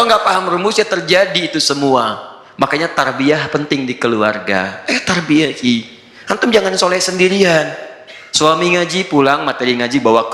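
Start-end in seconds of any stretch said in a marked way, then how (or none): none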